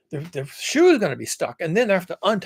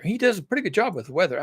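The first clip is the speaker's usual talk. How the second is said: normal talking voice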